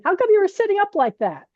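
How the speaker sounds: high, amused mock monk's question; imitating the teacher. The teacher questioning her upright sitting, high playful mock voice. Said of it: "So he goes to me".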